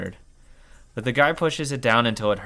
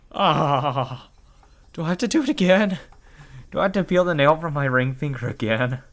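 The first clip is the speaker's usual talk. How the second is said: whimpering dread; 4 semitones higher, 3 dB louder than usual. Acted. Dreading another nail peeled.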